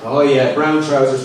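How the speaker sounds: usual speaking voice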